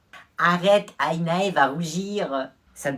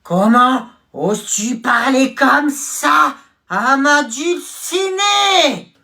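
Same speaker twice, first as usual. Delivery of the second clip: loud, high, slow dramatic outrage; hammed-up anime hero; 9 semitones higher, 11 dB louder, slower than usual. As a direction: drag it out like a shōnen hero. Anime hero defends his beloved.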